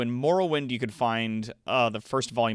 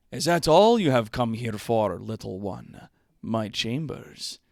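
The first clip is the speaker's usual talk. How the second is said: lusty lord voice; 3 dB louder than usual. Acted. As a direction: purr it like a sleazy nobleman. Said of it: "I'm playing both the characters".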